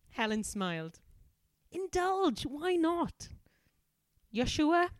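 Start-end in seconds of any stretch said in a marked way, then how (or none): 1.7-3.3 put-on high, posh English accent. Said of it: "Helen is gonna be properly posh". 4.3-4.9 put-on high Welsh accent. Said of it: "Vicky's gonna be Welsh".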